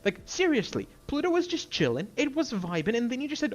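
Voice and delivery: high-pitched, exasperated ranting; worked up, pitch jumping high; angry rant. Real, not acted. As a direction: demand an answer in outrage. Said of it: "I did not expect to get this angry".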